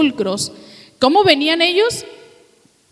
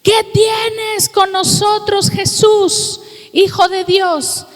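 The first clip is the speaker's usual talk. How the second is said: higher, drawn-out cry of possessed men; demons crying out; 7 semitones higher, 3 dB louder, slower than usual. Retold or imitated. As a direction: cry out slowly at a raised pitch, acting the demoniacs. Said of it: "porque clamar es gritar, le gritan".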